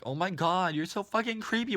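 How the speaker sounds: high, creeped-out squeal; mock-horrified, pitch climbing high. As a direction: act out being totally grossed out. Retold reaction to creepy driver.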